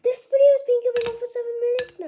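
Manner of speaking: high, loud and fast; excited shout. Real, not acted. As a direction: blurt it out in surprise. Surprised at video length.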